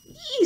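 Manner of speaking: very high, loud squeal; startled storybook pig squeal. Acted. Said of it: "squealed Pig".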